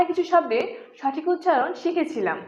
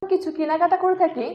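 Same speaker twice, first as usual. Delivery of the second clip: usual speaking voice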